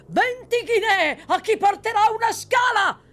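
very high, loud bellowed offer; shout over the crowd. Acted. The horseman calls to the crowd, bellowing. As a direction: roar to be heard above everyone. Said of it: "gridò sotto la finestra con una voce tale da vincere tutte le altre".

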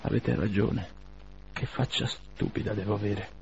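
soft, with a strained smile; forced smile. A narrator's reading. Wounded gentleman to Bazarov, with a forced smile. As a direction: smile through the embarrassment. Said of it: "con un sorriso forzato".

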